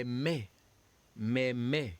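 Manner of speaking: "me" said with a clear closed e, like "may"; French "me". Shown as a demonstration. Teacher models the French word for students. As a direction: model "me" crisply for the class to repeat. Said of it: "we have me twice".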